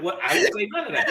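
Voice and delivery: a touch of high, laughing denial; mock denial. Someone blaming Donna, lightly voiced laughing denial. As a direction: lightly play someone dodging blame. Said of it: "It's like".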